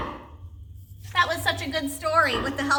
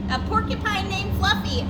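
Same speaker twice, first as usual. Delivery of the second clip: higher, a hint of howled laughter; howling laughter; 7 semitones higher than usual. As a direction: crow it with mock glee. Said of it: "howled the rhinoceros".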